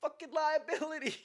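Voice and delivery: high, strained football-call shout; imagined coach yell. Pretend. Comic picturing Brady barking a play call at him, mock yell. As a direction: bark out a play call like a fired-up quarterback. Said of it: "yell something".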